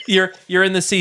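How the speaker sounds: ordinary talk, nothing marked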